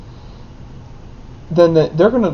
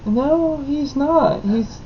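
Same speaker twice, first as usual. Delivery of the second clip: put-on a touch higher, matter-of-fact kid; small child correcting a classmate, slightly raised; lightly voiced child; 7 semitones higher, 4 dB quieter, slower than usual. Kindergartner tells classmate the truth, lightly higher. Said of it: "And I was just like".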